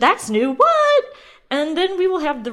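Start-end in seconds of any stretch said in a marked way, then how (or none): 0.5-1.0 sudden high startled "What?"; surprised what. Real, not acted. Host startled by something new, surprised squeak. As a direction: jump up in pitch, caught off guard.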